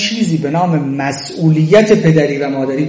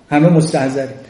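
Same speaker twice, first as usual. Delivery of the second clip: usual speaking voice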